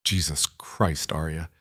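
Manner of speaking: soft, slow dismayed sigh of a name; quietly appalled, drawing it out; exasperated hush. Acted. Friend finding her washing blood in the bathroom, soft dismayed hush.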